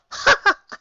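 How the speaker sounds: high refined little laugh; a dainty amused titter; high ladylike chuckle. A narrator's reading. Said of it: "she chuckled aloud to herself".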